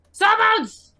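high, loud call across the deck; summoning call. Acted. Captain calls her crew, loud high call.

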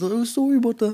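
a sheepish Canadian "sorry about that"; light Canadian accent. Retold or imitated. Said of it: "I do a little".

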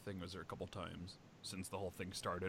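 ordinary talk, nothing marked

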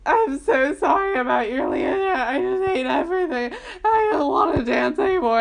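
high, loud sobbing through the words; bawling apology. Retold or imitated. Hugging her friend, high loud bawling apology. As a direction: wail it out, crying hard.

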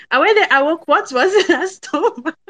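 breaking into a real laugh mid-line. Real, not acted. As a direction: try to get it out, then crack up. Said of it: "I'm laughing".

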